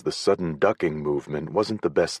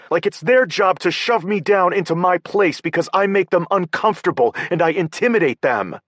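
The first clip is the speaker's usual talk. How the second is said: higher, louder, thick with anger; angry rant; 13 semitones higher, 8 dB louder than usual. A narrator's reading. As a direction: rail against the brother. Paige's angry thick-voiced rant.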